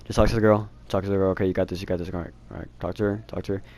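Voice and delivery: put-on nervous boy hypes himself up under his breath; quiet self pep talk. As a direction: mutter encouragement to yourself before talking to her. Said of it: "I kept telling myself".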